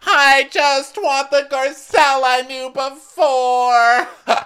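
loud, high, slow mock wailing; hammed-up wail. Rinna missing the old Garcelle, hammed-up wail. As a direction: stretch every word into a pleading howl. Acted quarrel, Rinna pleads.

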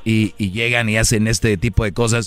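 deep, slow, altered storyteller voice. Acted. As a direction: drop into a low character voice. Low far put-on voice.

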